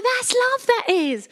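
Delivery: put-on swooning Essex accent.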